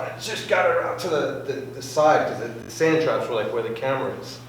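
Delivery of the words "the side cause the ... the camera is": usual speaking voice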